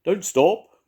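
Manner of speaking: high, loud owl call. A narrator's reading. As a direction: call after the flying bird. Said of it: "called the owl".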